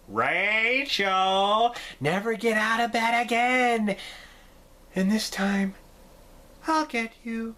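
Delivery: a hint of the creepy sister's taunt; Zelda. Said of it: "All the Zelda stuff".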